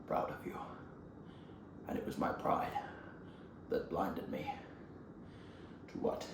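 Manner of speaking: very quiet, halting, mostly whispered; faint apology with long pauses between phrases; broken master. Acted. Beaten master confesses to his former pupil, faint and remorseful. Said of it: "Shifu's like".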